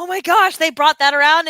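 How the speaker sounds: high, loud excited voicing; excited gasp. Friend imitating her excitement at the film, high and bright. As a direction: gush like a thrilled fan. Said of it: "And you're like".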